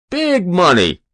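high, loud, falling emphasis; greedy lout. A narrator's reading. Hero repeating his terms, greedily.

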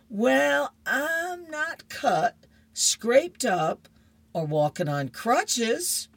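squeaky-voiced kid wriggling out of it; boy's voice. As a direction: play the kid dodging Mom's question. Boy Curtis answering his mom.

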